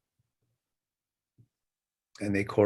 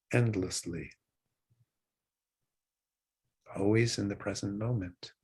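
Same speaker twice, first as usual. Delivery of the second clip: guided meditation. Acted. Teacher guiding students' breathing, calm meditation voice. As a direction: ease listeners back to the breath.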